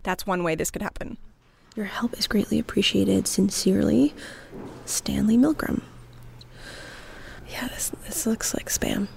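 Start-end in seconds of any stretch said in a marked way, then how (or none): 1.8-9.1 hushed library reading voice; whispering. Real, not acted. Researcher reads the letter's sign-off in the archive, whispering. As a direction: read it out under your breath. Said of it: "we had to whisper because, you know, library rules".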